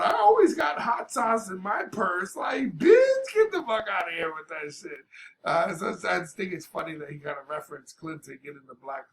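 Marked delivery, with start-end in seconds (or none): 0.0-2.4 higher and louder mocking quote; mocking impression of a politician, pitched up and loud; mock Hillary voice. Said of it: "talking about". 2.4-5.1 loud, high exasperated yell; louder outraged retort to the quote; indignant outburst. Real, not acted. Ranting at the mocked quote.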